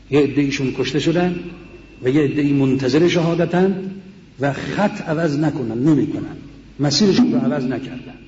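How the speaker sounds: normal talking voice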